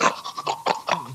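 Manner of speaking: unvoiced, wheezing snort-laugh; breathless snorting laugh. Mimicking the alien snorting with laughter on the couch. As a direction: imitate a friend helpless with laughter. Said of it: "He's going like".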